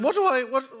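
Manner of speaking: high, loud mock actress voice; imitating the actress. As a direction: squeak it out like an anxious actress. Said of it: "and she said".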